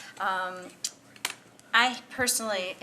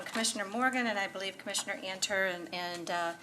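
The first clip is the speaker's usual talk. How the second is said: ordinary talk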